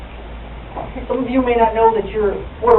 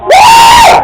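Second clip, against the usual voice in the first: very high, loud party "woo"; hammed-up celebratory scream; whooping cheer; 11 dB louder than usual. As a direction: let out a piercing drinker's whoop. Acting out drunken celebration.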